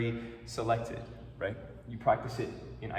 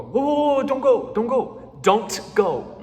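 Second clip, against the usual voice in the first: loud, higher mock plea "don't go"; acted plea; 10 semitones higher, 9 dB louder, slower than usual. Teacher acting a dramatic plea to class. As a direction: call out as if someone is leaving. Pleading in example.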